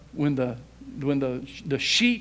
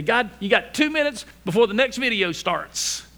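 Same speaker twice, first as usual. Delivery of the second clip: loud, higher-pitched mock impatience; rushed prayer; 5 semitones higher, 5 dB louder than usual. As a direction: act out hurrying God along, voice raised. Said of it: "we wanna rush in".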